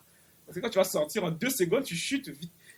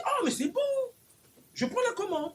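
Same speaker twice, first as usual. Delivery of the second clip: eager genie; 12 semitones higher than usual. Acted. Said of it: "Alors lui, il dit".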